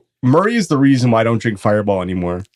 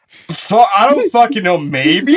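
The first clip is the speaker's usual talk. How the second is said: laughing; 7 semitones higher, 3 dB louder, slower than usual. Retold or imitated. Groggy son answers, laughing.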